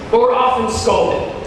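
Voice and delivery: ordinary delivery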